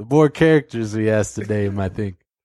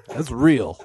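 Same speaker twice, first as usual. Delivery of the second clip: ordinary delivery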